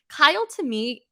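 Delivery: ordinary delivery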